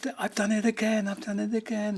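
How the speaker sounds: high-pitched, distraught self-blame; imitated tearful wail. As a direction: mimic a friend wailing as he flees. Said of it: "he runs out crying".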